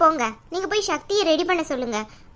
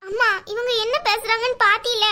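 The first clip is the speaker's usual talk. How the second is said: teary protest to mother; tearful complaint; 6 semitones higher than usual. Acted. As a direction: voice pitched up, on the edge of tears. Sister complaining, about to cry.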